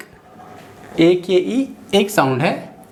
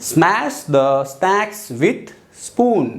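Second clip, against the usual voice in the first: very slow, word-by-word sentence; slow dictation; much slower than usual. Shown as a demonstration. Teacher reading a practice sentence very slowly. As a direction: space every word out for the learners. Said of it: "fifth example है".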